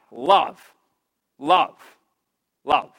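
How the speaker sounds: low, loud, one word at a time; emphatic love. Real, not acted. Preacher to congregation, loud emphatic repeats. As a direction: drive each love home. Preacher hammering home the answer.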